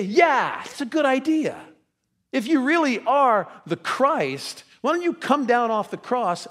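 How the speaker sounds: loud, high-pitched taunt, stretched out; jeering taunt. Acted. Priests jeering at Jesus on the cross, loud mocking taunt. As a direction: call out a challenge like a scornful ruler.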